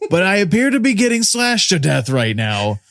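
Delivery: high, laughing hammy announcement of own death; overacted death scene. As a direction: ham up a dying man's news. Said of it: "be sure to be shouting that from the rooftops".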